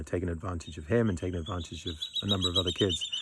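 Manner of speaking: ordinary delivery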